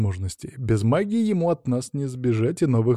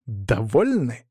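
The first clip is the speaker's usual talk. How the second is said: slow, mock-grudging question; drawn-out pretend annoyance; feigned displeasure; much slower than usual. A narrator's reading. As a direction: grumble it as if put out. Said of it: "буркнул я наигранно недовольным голосом".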